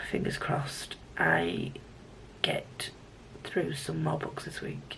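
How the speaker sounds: hushed, whispering wish; whispered hope. Real, not acted. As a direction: drop to a slow-starting, secretive whisper. Hopeful sign-off sinking to a whisper.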